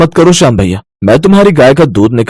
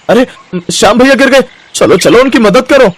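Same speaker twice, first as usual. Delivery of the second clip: higher, urgent shout for help; rally the others to help him; urgent call; 7 semitones higher than usual. Acted. Villager calling others to help fallen Shyam, urgent.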